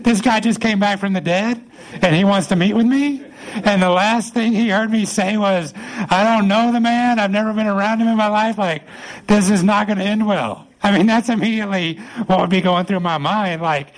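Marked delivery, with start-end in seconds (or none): 0.0-10.7 mildly alarmed, pitched up; acted worry, voice lifted high; a little scared. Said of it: "I'd feel a little scared".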